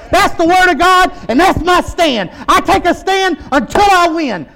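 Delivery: declaration building to a high, loud shout; impassioned preacher's confession of faith; full-force preaching. Real, not acted. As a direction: declare it with mounting force and conviction.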